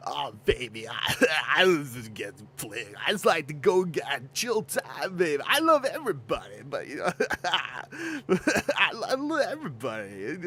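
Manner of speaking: lazy drawling dude voice with laughs; chill stoner dude. Pretend. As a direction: ramble like a laid-back stoner. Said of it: "Sounds like a chill Looney Tunes Tasmanian devil".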